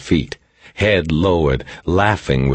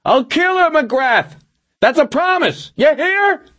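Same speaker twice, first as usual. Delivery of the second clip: very high screamed threat; high, frantic hostage-taker yell; Milosevic screaming. A narrator's reading. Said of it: "Milosevic screamed".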